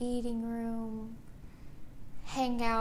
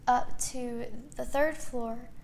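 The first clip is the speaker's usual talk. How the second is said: normal talking voice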